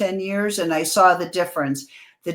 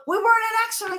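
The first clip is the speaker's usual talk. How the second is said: mock panicked passengers; 14 semitones higher, much faster than usual. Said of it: "they go".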